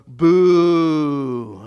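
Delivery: a loud, drawn-out boo; a loud crowd-style boo. Shown as a demonstration. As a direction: boo them like a villain. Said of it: "that's just to go".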